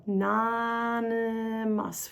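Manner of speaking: "anonymous" soft and slow, N flowing into M; N and M drawn out. Shown as a demonstration. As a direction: hold the N and M in "anonymous". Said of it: "We've got the N and the M going together".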